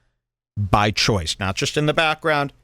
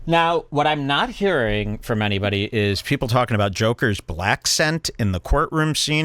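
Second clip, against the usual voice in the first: ordinary talk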